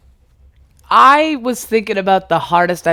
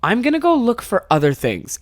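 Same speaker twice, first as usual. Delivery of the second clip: higher-pitched, baffled mimicry; imitating unamused girlfriend; 6 semitones higher than usual. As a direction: play the girlfriend who doesn't get it. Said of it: "Didn't get it. Was just like".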